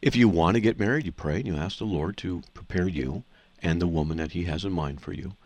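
ordinary talk

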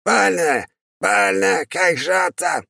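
high shrieking insect demon; chittering insect creature. Acted. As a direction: screech like a pained chittering bug. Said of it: "Стрекочущий голос заверещал".

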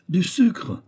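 a sharp, high cry of disbelief; exclamation. A narrator's reading. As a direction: cry it out, stunned by his method. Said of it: "s'écria le vieux professeur". (s'écrier, plus aigu)

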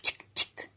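voiceless clicky "chic, chic"; crisp unvoiced rattle noise; imitated branch rattle. A narrator's reading. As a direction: imitate a shaken branch with the mouth. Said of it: "no te olvides de hacerla sonar".

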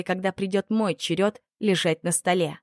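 ordinary delivery, nothing marked

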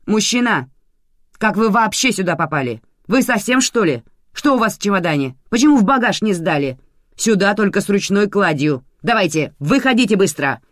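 high, loud scolding; loud, indignant dressing-down; shouting. A narrator's reading. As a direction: shout orders like an irate boss. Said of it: "закричала начальница".

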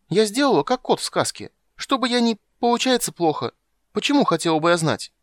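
put-on high, loud child asking; childish eager question; little girl voice. A narrator's reading. (голос маленькой девочки)

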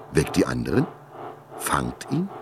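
incredulous echo. A narrator's reading. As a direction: repeat her words in dazed disbelief. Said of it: "erwachte Langer Schatten aus seiner Starre".

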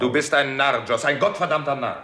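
furious at a reckless friend; angry outburst. Acted. Friend berating stubborn Joss over the letter, angry shout.

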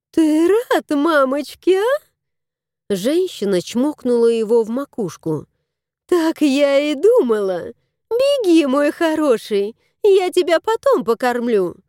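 very high, sing-song cooing; baby talk. Acted. Lady baby-talking the cat on her lap. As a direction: gush like over a baby.

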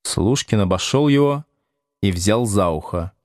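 ordinary delivery, nothing marked

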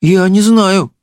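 put-on high girlish voice; high-pitched doubtful princess; princess, unsure. A narrator's reading. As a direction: puzzle aloud in a high voice. Princess hesitates.

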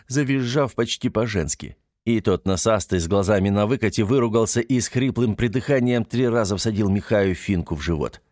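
ordinary delivery, nothing marked

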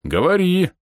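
loud regal command; majestic. A narrator's reading. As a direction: command like a king. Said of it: "величественно кивнул полуорг". (величественно)

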